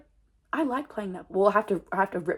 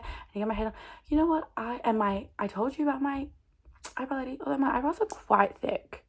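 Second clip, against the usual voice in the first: normal talking voice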